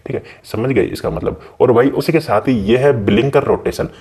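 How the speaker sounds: normal talking voice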